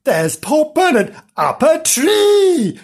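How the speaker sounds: high, drawn-out exclamation; hammed-up high surprise, last word stretched; surprised cry. A narrator's reading. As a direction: call out in astonishment. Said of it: "Gillian in surprise".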